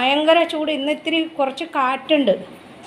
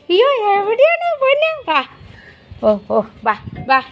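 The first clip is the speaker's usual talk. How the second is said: very high, sing-song call; calling the pet; 6 semitones higher than usual. Real, not acted. Calling for pet shut behind door. (ഉയർന്ന സ്വരത്തിൽ ഓമനിച്ച് വിളിച്ച്)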